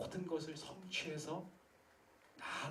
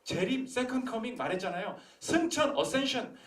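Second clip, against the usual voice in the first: loud, high fervent preaching; preaching at full force; 10 semitones higher, 11 dB louder than usual. Acted. Pastor challenging the congregation, loud fervent preaching. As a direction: drive it home to the pews.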